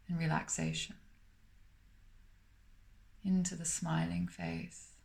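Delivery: calm, unhurried meditation voice with long pauses between phrases; guided meditation. Acted. Meditation teacher guiding listeners to smile through the body, calm and spacious.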